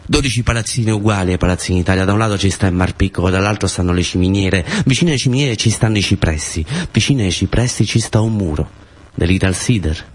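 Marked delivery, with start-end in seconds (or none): none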